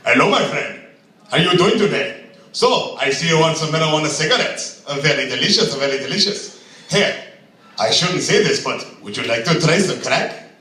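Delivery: lightly voiced overly friendly shop clerk; a hint of a smarmy salesman pushing product; imagined store clerk. Clerk at a seven eleven offering pot, lightly hammed-up salesman. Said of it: "this is not how the conversation will ever go".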